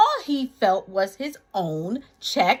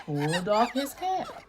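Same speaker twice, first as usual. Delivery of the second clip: usual speaking voice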